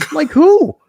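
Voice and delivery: high, loud "like who?" in disbelief; retold incredulous challenge. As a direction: snap back in shocked disbelief. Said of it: "I go".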